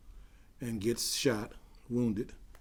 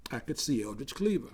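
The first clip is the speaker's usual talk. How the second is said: usual speaking voice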